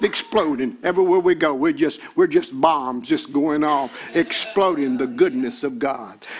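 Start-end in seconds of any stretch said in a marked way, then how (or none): none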